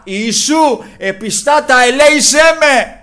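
high, building shout of appeal; loud crying out. Retold or imitated. Blind man crying out to Jesus, loud crying out. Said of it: "Άρχισε να φωνάζει δυνατά".

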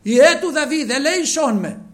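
blind beggar pleading at full voice, high and loud; the beggar shouting to Jesus, high and loud; crying out loud. Retold or imitated. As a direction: cry out for mercy with all your strength. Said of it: "έπιασε να φωνάζει δυνατά".

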